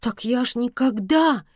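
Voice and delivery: high, earnest protest; fervent assurance. A narrator's reading. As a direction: protest your innocence warmly. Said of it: "горячо заверила её Света".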